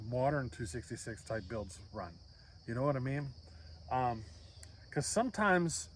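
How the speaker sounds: ordinary talk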